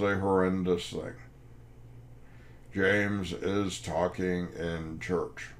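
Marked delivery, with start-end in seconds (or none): 2.7-5.5 very slow, word-by-word accusation; grave nun's complaint. Retold or imitated. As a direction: spell out the offence slowly, imitating a stern nun. Repeats the nun's horrendous accusation.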